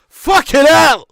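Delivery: loud, high swearing outburst; furious shout. Real, not acted. Fan watching his team nearly concede, real shout. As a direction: bellow it in real disbelief at the save. Near goal conceded, live reaction.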